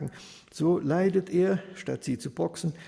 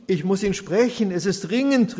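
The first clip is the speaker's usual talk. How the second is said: louder, higher pleading call; urgent widow calling at the door, louder; 5 semitones higher, 7 dB louder than usual. Acted. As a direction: wring your hands and call out. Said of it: "rief da die Witwe händeringend".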